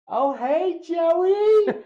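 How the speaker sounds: high, loud imitation of an excited nurse; hammed-up shrieky greeting; calling out a greeting. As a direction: belt out an over-the-top hello.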